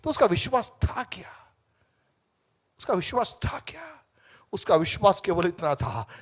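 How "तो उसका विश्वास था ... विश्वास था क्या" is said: hushed, breathy question to the crowd; whispered question. Real, not acted. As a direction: lean in and whisper it. (फुसफुसाकर सवाल)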